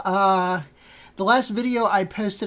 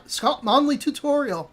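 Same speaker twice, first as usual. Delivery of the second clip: higher-pitched mock Scottish accent; 7 semitones higher, 3 dB louder than usual. Shown as a demonstration. Host trying out the tutorial maker's name in mock Scottish accent. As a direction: put on an amateur Scottish accent. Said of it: "put on my Scottish accent".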